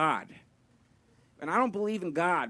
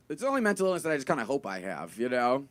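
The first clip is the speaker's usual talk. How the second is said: usual speaking voice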